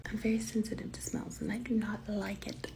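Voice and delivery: hushed whisper so nobody hears; soft unvoiced whisper about the smell. Real, not acted. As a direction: whisper it under your breath in a waiting room. Whispering in the doctor's office.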